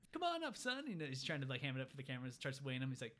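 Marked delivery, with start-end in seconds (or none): 0.1-0.9 high-pitched showy host; mock showman's come-on; hamming it up. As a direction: play it up big for the camera, pitched way up. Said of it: "all smiles and hammering up for the cameraman".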